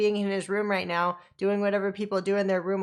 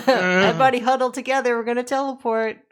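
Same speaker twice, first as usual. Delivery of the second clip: raised, higher rallying call; 6 semitones higher, 6 dB louder than usual. Acted. Player rallies the party before the teleport, calling out. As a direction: gather the group with a loud call.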